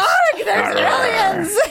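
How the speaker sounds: slow laughing high pirate growl. Pretend. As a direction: ham up a pirate cry. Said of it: "I read it like a pirate".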